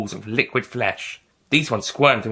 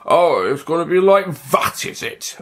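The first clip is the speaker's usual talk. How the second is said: cross; 6 semitones higher, 3 dB louder than usual. Acted. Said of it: "the doctor asked crossly".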